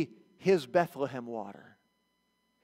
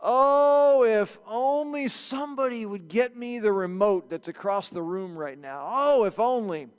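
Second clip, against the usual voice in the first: hinting sigh; 3 semitones higher, slower than usual. Retold or imitated. Someone longing aloud so others fetch the remote. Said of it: "Have you ever said something in the presence".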